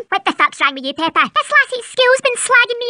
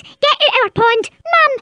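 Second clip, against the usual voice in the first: usual speaking voice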